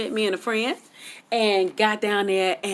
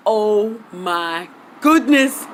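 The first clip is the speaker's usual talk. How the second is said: awestruck; 2 semitones higher, 4 dB louder, much slower than usual. Retold or imitated. Awestruck on seeing Ric Flair.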